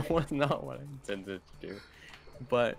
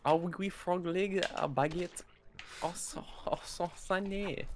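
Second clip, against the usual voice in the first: higher, hammed-up fake French; mock French accent; 7 semitones higher than usual. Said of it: "I'm so sorry to all French people".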